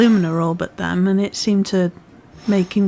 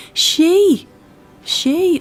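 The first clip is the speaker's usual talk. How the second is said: calling out; 9 semitones higher, 3 dB louder than usual. Real, not acted. Shouting at Pokemon in game.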